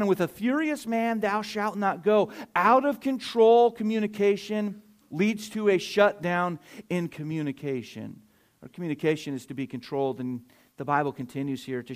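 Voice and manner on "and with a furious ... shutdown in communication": raised, louder preacher's voice; higher, emphatic pulpit delivery; forceful preaching. Real, not acted. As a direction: drive the point home to the congregation.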